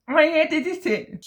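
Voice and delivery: I edited it! squeaked high and slow; high slow mock squeal. Shown as a demonstration.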